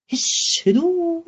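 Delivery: unvoiced shh, then a soft hushed هدوء. Shown as a demonstration. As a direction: hush the room into silence. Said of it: "بنفي كل الأصوات". (همس للتهدئة)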